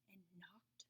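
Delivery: whispered, tiptoeing; hushed suspense; nervous whisper. A narrator's reading. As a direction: barely breathe it. Nervous at enemy's door.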